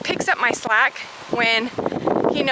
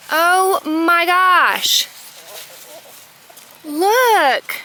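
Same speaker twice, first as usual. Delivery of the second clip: drawn-out, slow oh my gosh; slow amazed exclamation; delighted gasp; 5 semitones higher, 4 dB louder, much slower than usual. Real, not acted. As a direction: stretch it out in real delight. Delighted by eggplant find.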